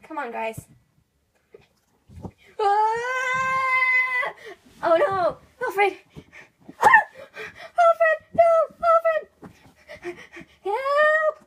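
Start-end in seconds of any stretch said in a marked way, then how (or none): 2.6-11.4 high, loud, frantic screams for a falling friend; panicked cries. Pretend. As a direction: scream after him as he drops.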